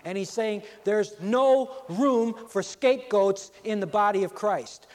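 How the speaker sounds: preacher building to full force; building sermon climax. Acted. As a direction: pile it on, each line bigger.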